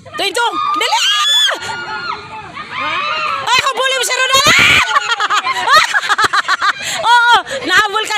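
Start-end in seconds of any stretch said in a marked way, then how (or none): 0.0-4.4 calling out. Real, not acted. Host calling out the game. 4.5-7.0 loud burst of laughter. Real, not acted. Laughing while the game runs.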